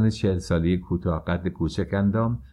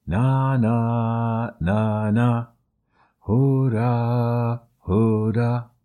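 crowd shouting; 3 semitones higher, much slower than usual. A narrator's reading.

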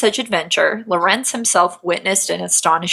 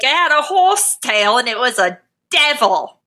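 hammed-up British accent; 3 semitones higher than usual. Colonial man describes the beast, hammed-up British accent. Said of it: "the stereotypical colonial British male".

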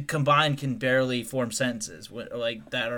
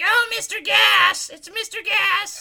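high, slow mocking sing-song call; much higher, drawn-out teasing call of a nickname; coworkers calling out; 7 dB louder, slower than usual. Imitating coworkers greeting him as Mister Gas, high and drawn out. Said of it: "They're like".

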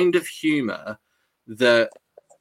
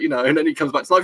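ordinary talk, nothing marked

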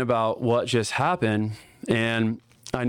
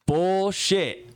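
a higher, louder retold "Bullshit"; loud disbelief; 8 semitones higher than usual. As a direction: shout "Bullshit" back in disbelief.

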